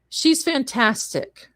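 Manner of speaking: usual speaking voice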